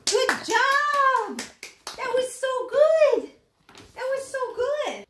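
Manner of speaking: high, drawn-out gushing to a dog; delighted praise. Real, not acted. Owner praising the dog after the first run, delighted. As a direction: gush high and proud.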